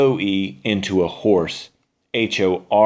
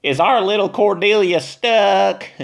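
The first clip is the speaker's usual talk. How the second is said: much higher mocking witch voice; cackling witch; 10 semitones higher than usual. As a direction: taunt her with a cackle in your voice. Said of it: "Beulah cackled again".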